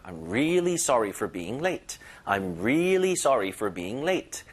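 "really" leaned on and drawn out; stretched stress on really. Shown as a demonstration. English teacher models an apology for lateness, "really" stressed and lengthened. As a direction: stretch the first syllable of "really" with some force. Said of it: "힘을 주면서 살짝 끌어주는 거예요".

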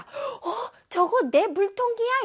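high-pitched, acted gasp of recognition; startled question. Pupil spots a friend drinking their bottle, acted startled question.

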